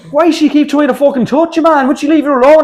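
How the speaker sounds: high, loud, mock-baffled lad; mate's voice. Retelling what the Irish lad kept asking him, high baffled voice. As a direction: play the mate asking in disbelief. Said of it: "he kept coming up to me going".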